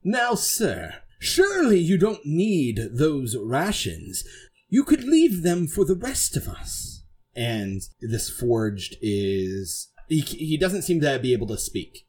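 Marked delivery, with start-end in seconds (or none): 0.0-7.0 high, lilting knightly address; Sir Braddock. Acted. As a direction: politely press him in a put-on knight's voice. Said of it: "Sir Braddock has walked up to him, and he goes".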